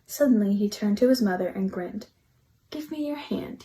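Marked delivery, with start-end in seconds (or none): 2.7-3.6 soft, higher whisper. Acted. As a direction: whisper it, a little secret. Chester asks secretly.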